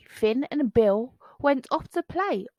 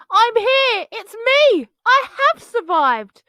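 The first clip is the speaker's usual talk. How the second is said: high, loud relieved shout; cry out; 12 semitones higher, 10 dB louder than usual. Acted. Bonehead shouting to returning friends, high and loud. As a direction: call out from the wreckage. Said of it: "a muffled cry".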